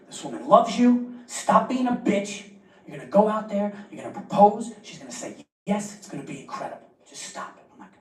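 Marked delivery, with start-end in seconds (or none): 0.1-4.7 man in restaurant bathroom giving himself a pep talk. Retold or imitated. 4.8-7.6 dropping to a hush; pep talk sinking to a whisper; fading pep talk. Retold or imitated.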